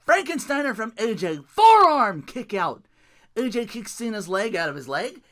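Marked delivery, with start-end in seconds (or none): 1.5-2.9 loud, slow drawn-out call; excited call. Real, not acted. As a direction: belt it out like a hyped commentator.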